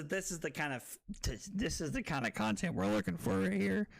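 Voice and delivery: usual speaking voice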